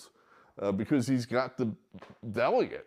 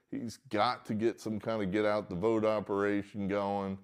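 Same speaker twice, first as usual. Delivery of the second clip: normal talking voice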